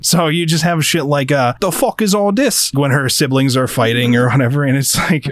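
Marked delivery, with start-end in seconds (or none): 1.6-2.7 higher-pitched mock Cockney exclamation; Cockney accent. Host quotes a manga translation of Kansai dialect, mock Cockney accent. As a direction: do an exasperated line in put-on Cockney. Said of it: "a fucking Cockney British accent".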